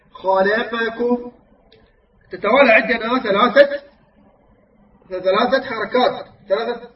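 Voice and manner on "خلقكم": "خلقكم" ببطء وكل فتحة كاملة; رتّل "خلقكم" مبيّنًا الفتحات الثلاث; فتحات تامّة. Shown as a demonstration. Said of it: "وكذلك في قوله تعالى". (تلاوة "خلقكم" متأنية بلا اختلاس)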